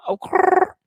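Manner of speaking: high, loud delighted squeal; excited squeal. Real, not acted. Thanking viewers for Instagram badges, squealing with delight.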